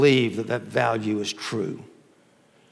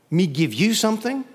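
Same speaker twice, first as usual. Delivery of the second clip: higher-pitched, incredulous question; shocked; 6 semitones higher than usual. Acted. Beggar answering the prince's request, shocked. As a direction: act taken aback at the request. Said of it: "And the man was shocked".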